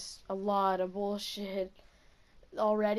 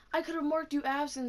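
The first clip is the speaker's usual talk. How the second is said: mild mock yell; retold yelling; 5 semitones higher than usual. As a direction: imitate a teacher telling a student off. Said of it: "just started yelling at me".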